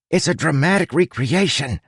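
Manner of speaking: high, mildly hissed retort; high, defensive rat voice. A narrator's reading. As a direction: hiss it as an affronted rat. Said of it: "Huck hissed".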